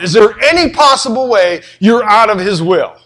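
normal talking voice

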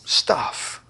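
breathy whisper, tossed off; whispered aside. Real, not acted. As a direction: drop the word away as trivial. Dismissive aside about old promise.

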